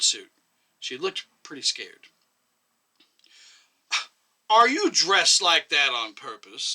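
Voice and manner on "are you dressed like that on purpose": slow, loud, severe question; stern. A narrator's reading. Chairman sternly confronts the nurse about her suit, slow and loud. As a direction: drag each word out like a rebuke. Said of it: "asked sternly".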